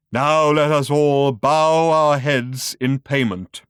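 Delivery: slow, solemn intoned call; intoning. A narrator's reading. Showman intones a solemn call to bow heads. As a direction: intone it like a priest. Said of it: "intoned the voice".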